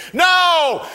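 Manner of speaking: loud, high drawn-out group shout; team shouts no. Retold or imitated. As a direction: roar it back. Rookies shout answer.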